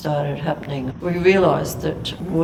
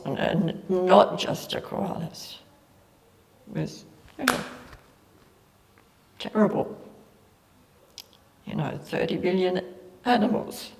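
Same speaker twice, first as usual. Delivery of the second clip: breathy, tear-choked near whisper; barely holding it together; teary, choked up; 3 semitones higher, 4 dB quieter than usual. Real, not acted. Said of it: "sorry, I get a bit teary about this".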